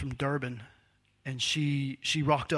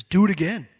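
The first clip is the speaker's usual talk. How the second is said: commanding order; 5 semitones higher, 11 dB louder than usual. Retold or imitated. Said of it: "he says".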